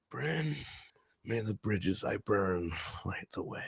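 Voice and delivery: slow, breathy and hushed. Pretend. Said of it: "Do, do a quick [name] line for me".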